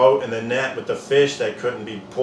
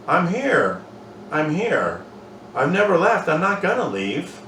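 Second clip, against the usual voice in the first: reassuring; 5 semitones higher than usual. Pretend. Voicing God's reassurance.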